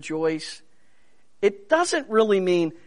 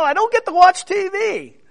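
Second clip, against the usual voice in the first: high, loud, whining plea from a jail cell; whiny prisoner complaint; 12 semitones higher, 7 dB louder than usual. Retold or imitated. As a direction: play the complaining prisoner, pitched way up. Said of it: "if I'm in prison, expect to get a letter".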